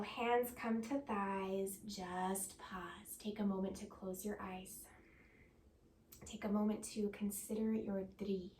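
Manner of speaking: ordinary talk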